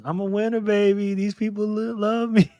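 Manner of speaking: high, breezy diva impression. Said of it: "in my [name] voice".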